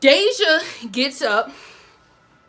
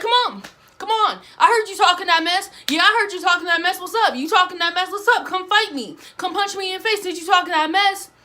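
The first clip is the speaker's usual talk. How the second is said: higher, fast taunting challenge; mimicking Tanisha taunting; 5 semitones higher, faster than usual. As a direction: egg her on with a high, cocky voice. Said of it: "Tanisha was like".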